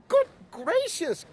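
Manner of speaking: high gasp of surprise; surprised bird gasping. A narrator's reading. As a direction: catch your breath in shock. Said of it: "gasped Maisie".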